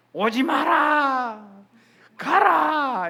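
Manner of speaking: loud, high acted yell through a door; hostile shout. Shaman yelling at visitors ringing the bell, hostile acted shout. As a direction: shout an unwelcome visitor away, imitating the shaman.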